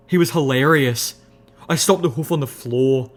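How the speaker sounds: ordinary delivery, nothing marked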